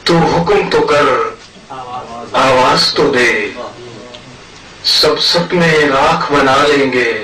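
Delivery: measured ghazal recitation with long pauses; poetry recital. Acted. Poet presents his verses to a mushaira audience, poetry recital. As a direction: recite in separate phrases, lifting openings and letting ends fall.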